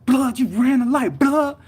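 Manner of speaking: high, loud outraged shout; retold angry yell. Injured driver to the man who hit him, mad yelling. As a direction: yell it as an accusation at the other driver. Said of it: "I was, like, yelling".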